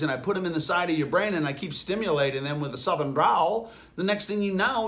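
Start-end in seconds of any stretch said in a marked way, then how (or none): none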